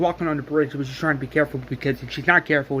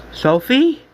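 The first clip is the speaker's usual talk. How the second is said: high, rising call; higher, louder searching question; 10 semitones higher, 6 dB louder than usual. Retold or imitated. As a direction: call the name out uncertainly, pitched high. Calling out to the fallen Sophie.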